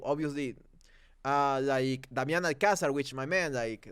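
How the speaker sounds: ordinary delivery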